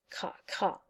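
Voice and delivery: cra cra chuchoté, sans voix; grattement de plume imité. Retold or imitated. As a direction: imiter tout bas une plume qui gratte. Bruit de plume qui écrit. (bruit de plume, chuchoté)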